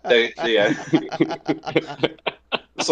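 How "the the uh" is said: laughing, slowed mock Simpsons Australian accent; hammed-up fake Aussie voice, laughing through it; mock bad Australian accent. Mocking The Simpsons' botched Aussie accent, laughing. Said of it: "tried to pull off an Australian accent".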